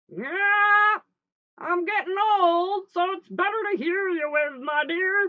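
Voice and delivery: loud, high, slow fake granny; wolf posing as Grandma. Wolf fools Little Red from bed, high and loud. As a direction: coo it big and drawn out.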